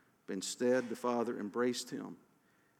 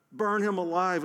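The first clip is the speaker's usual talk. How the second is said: loud, high shout from the crowd; raised voice demanding punishment; 6 semitones higher, 6 dB louder than usual. A narrator's reading. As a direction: shout it out, pitched up. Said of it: "another voice shouted".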